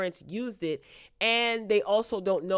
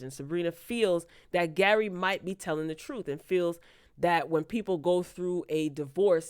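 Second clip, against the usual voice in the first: ordinary talk, nothing marked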